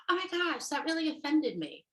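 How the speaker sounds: high-pitched mock offence; put-on, oversensitive high complaint; offended partner. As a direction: play the easily hurt partner in a high voice. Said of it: "I don't know why I'm talking like this".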